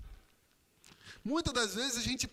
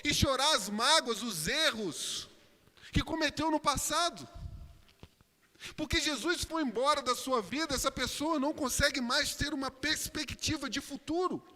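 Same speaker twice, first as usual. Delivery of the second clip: higher, louder preaching; pregação enfática; 6 semitones higher, 6 dB louder than usual. Acted. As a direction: preach it forcefully to the congregation. Preaches at full force. (pregação em voz alta e enfática)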